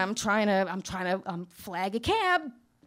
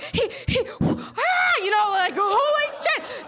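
much higher, shrill-free squeal of mock panic; scared waiting-room ladies; 12 semitones higher, 3 dB louder, slower than usual. Waiting-room ladies reacting in mock panic to the screaming. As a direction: squeal like a frightened woman waiting. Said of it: "ladies are sitting in the waiting room like".

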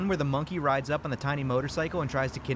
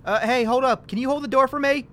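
loud, higher call across the parking lot; 9 semitones higher, 7 dB louder than usual. Acted. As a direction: call out to stop her at the door. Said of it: "Randy calls out to her".

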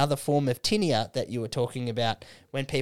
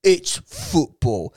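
slow, put-on know-it-all teenager voice; slow smug teen fan; mock teen fan; slower than usual. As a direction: lecture it slowly like a smug thirteen-year-old. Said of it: "you're like".

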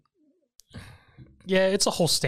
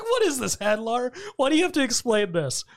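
much higher, disbelieving whine; incredulous mock complaint; 7 semitones higher than usual. Fan mocking Hadlar's monologue before the attack, high and incredulous. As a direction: squeak out your disbelief at the speech. Mocking the villain's long explanation.

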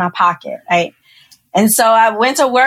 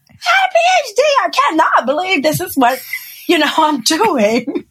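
frustrated inner shout; 9 semitones higher than usual. Retold or imitated. Thinking to herself in the kitchen, a high frustrated mock yell. Inner thought while cooking.

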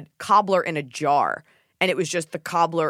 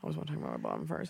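much lower, fast goofy mumble; silly low voice; 14 semitones lower, 14 dB quieter, much faster than usual. Acted. Host jokes about her bottom, silly low voice. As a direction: drop into a deep joke voice.

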